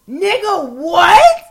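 high, loud incredulous shriek; outraged disbelief. Retold or imitated. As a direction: explode in disbelief, rising on the last word. Outraged reaction to the story.

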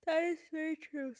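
words stretched by a yawn. Real, not acted. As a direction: say it mid-yawn. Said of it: "Sorry, I'm yawning".

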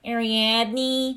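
Ariadne with a nasal Midwestern twang; Midwestern accent. Retold or imitated. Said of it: "I don't like".

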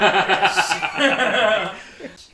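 big burst of real laughter; loud laugh. Real, not acted. Host cracking up at his own bad Scottish accent, loud laugh. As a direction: crack up loudly. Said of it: "laughs after accent".

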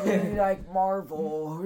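slow, high, put-on old man; a doddering grandpa musing. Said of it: "like a soothing old man voice".

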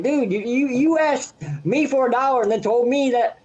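incredulous retold tirade; retold angry outburst. Retelling angry confrontation with beggar.

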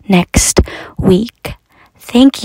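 whispered sign-off tag. Real, not acted. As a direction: breathe it as a secret aside. Signing off the podcast.

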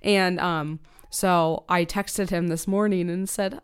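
ordinary talk

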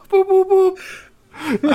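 very high squeaky robot boops; toy robot voice. Retold or imitated. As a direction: beep like a tiny toy robot. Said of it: "it was the voice".

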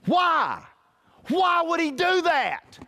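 an aching, disbelieving plea; pleading question. Acted. Preacher poses a rhetorical question.

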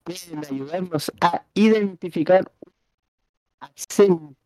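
deliberate slow read-aloud; slow rereading. Shown as a demonstration. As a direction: read it slowly, pausing before the last word. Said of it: "Volvelo a leer lentamente".

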